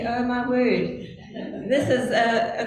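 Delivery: half-laughing at her own dismay; a nervous laugh through the words. Retold or imitated. Reading the gospel and seeing the warning, laughing. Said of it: "and I see".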